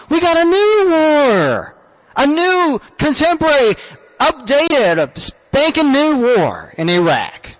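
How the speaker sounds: drawn out, mock-grand announcement; slow sarcastic announcement. Host to audience, slow sarcastic announcement. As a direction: lay it on slowly like a showman.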